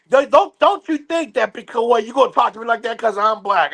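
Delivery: high, accusatory mock rant; accusing customer. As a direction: accuse him of racism. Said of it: "She's like".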